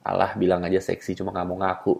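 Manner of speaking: low, soft knowing aside; knowing scoff. Acted. Man silently doubting her denial, low knowing scoff. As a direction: scoff quietly under the voice. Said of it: "ekspresi bahwa".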